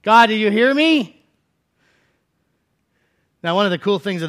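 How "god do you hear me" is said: slow then loud, high desperate plea; Elijah crying out. Acted. Elijah alone in the wilderness, crying out desperately to God. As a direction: cry out to God feeling abandoned. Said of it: "Elijah cries out".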